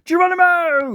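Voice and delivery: high, loud battle cry; shouted. A narrator's reading. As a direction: yell it like a daredevil leaping. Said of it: "shouted Daddy".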